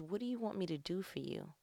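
soft, tender question from God; gentle God. Acted. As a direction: ask it gently, like a father.

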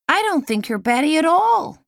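put-on high and loud, a boy's eager reassurance; young boy Carl. A narrator's reading. Boy assuring Lizzie she isn't batty, loud and high.

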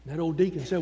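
ordinary delivery

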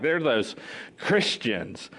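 low, disdainful label, pausing before CHRISTIANS; mock put-down. People label the early followers of Jesus, mildly scornful. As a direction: drop the last word with mild disdain. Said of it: "It was a put down".

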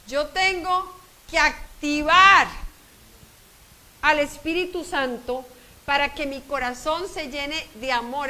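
loud, slow, emphatic preaching; full-force preaching. Acted. Preacher urging listeners to activate the Spirit, fervent. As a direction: drive each word home slowly.

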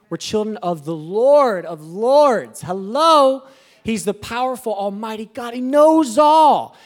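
rising, then a slow ringing proclamation; preaching at full force. Acted.

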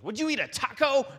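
high, loud put-on flight attendant voice. Flight attendant jokingly offers sick passenger a taco, high put-on voice. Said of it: "and she goes".

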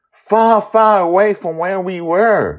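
Joy's voice. Acted. Joy telling Amali they are lost, high loud child voice. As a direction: stretch out far.

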